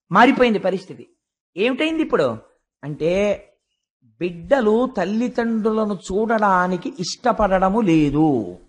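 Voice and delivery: normal talking voice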